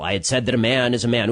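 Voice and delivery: ordinary talk, nothing marked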